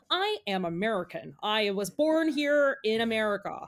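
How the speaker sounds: badly faked American accent, over-pronounced; British actor's American accent. Mocking British actors in movies, hammed-up American accent. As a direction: imitate a Brit overdoing an American accent. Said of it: "we have British actors going".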